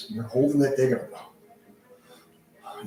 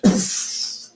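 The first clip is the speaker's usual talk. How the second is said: normal talking voice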